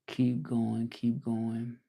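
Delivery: normal talking voice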